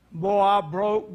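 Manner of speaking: ordinary talk, nothing marked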